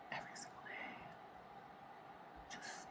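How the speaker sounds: a spent, whispered echo of the line. Real, not acted. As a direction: let it fade to a hushed whisper. Repeats phrase, second time whispered.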